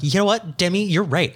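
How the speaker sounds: put-on high, eager ditzy girl voice; mock ditzy contestant. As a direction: play the gullible contestant, way up high. Imitating contestant being manipulated.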